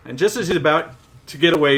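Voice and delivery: normal talking voice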